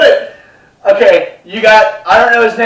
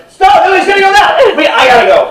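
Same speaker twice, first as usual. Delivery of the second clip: high, loud comic protest; mock panicked shouting; 11 semitones higher, 4 dB louder, faster than usual. Host to guest at sign-off, mock alarmed shouting. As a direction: yell as if being attacked.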